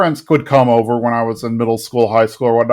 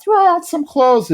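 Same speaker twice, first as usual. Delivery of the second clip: mock yell. Kids yelling at the closet, high mock call. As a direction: imitate a crowd calling out, high and slow. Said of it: "people are starting to yell out".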